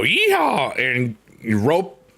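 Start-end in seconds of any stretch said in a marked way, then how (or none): 0.0-0.8 high whooping yeehaw; cowboy yeehaw. Pretend. Streamer acting out roping, with a high cowboy whoop. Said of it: "You go".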